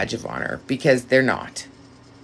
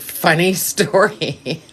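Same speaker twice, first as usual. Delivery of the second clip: slow, laughing through the words; amused, laughing as she tells it; 3 semitones higher, slower than usual. Real, not acted. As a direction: chuckle it out slowly. Laughing at being called raspy.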